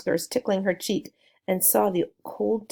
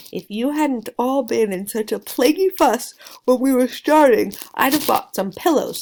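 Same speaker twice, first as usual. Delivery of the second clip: fussy Missus Beaver; 5 semitones higher, 7 dB louder than usual. Acted. Missus Beaver scolds the others.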